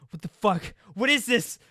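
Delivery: high, loud terrified yell; panicked scream. Acted. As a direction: scream in horror at the light.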